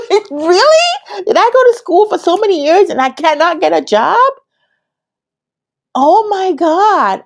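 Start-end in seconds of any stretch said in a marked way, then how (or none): 0.1-2.6 really?? said long and high; disbelief. Real, not acted. Disbelief, slow, high, far. 6.0-7.2 slow, high, drawn-out groan of disbelief; long high oh my God; exasperated. Real, not acted.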